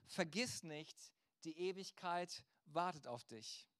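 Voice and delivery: normal talking voice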